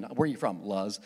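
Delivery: ordinary talk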